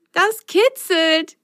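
slow high giggly voice; giggling. Acted. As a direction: giggle the words out. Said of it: "kicherte sie".

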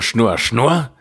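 ordinary delivery

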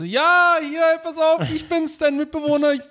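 awkward friendly voice. Retold or imitated. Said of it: "Ich so".

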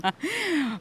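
short high burst of laughter. Real, not acted. Laugh after the intro.

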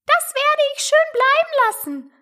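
very high indignant mouse voice; huffy mouse. A narrator's reading. Offended mouse refusing Alice as it leaves, huffily. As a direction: refuse in a squeaky huff.

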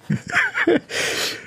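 breathy high-pitched giggle; high squeaky laugh. Real, not acted. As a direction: squeak with laughter, nearly silent. Laughs at cohost not swallowing.

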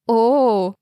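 loud, high drawn-out oh; mock surprise. A narrator's reading. Two snobby girls react to the brother's costume with mock surprise. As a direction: feign being impressed. Girls raise eyebrows mockingly.

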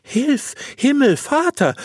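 very high startled exclamation; high-pitched appeal to heaven; shocked cry. A narrator's reading. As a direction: cry out high in alarm, as the astonished wife. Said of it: "rief sie".

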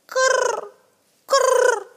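very high rolled krrr; dove coo. Acted. Wood doves call down to Gerda with high krrr coos.

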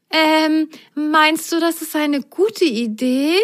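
put-on high, hesitant girl's voice; doubting Rosenrot. Rosenrot asks her friend, doubtful and high. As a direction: ask it unsure, a little child. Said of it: "Zweifelte Rosenrot".

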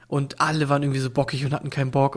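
ordinary talk, nothing marked

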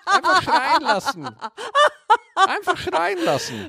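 hoch, lachend wiederholt. Real, not acted. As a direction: halb lachend übertreiben. Wiederholt lachend seine Erziehungsthese. (lachend und überspitzt)

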